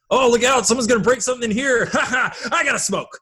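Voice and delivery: high, lively cartoonish character voice with a laugh; lightly hammed-up Ness impression; imitating Ness. Quoting Ed's ad-lib as Ness to podcast hosts, lightly hammed up. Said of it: "Ed as Ness is like".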